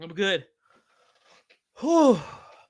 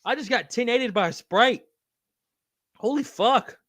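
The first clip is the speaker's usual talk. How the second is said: normal talking voice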